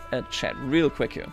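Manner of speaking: ordinary talk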